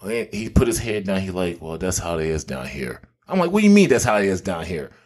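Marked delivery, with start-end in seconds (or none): 1.6-3.0 low, soft imitation of a downcast friend; resigned. Friend's downcast reply, head down. 3.5-4.9 retold disbelieving question at normal volume; incredulous retort. His incredulous retort to friend.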